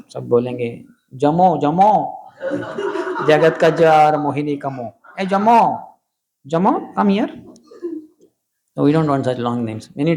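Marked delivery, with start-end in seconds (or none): 1.1-7.3 calling out a nickname. Retold or imitated. People calling a child by nickname, mock calling out. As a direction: call out across the room to someone.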